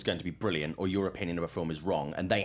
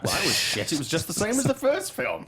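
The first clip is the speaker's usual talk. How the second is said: a hammed-up moaner, much higher at the end; mock whiny audience; 11 semitones higher, 3 dB louder than usual. Mocking the audience's Zoolander two gripe, whiny and high. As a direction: whine it high like a let-down fan, climbing on the last line. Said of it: "everyone's like".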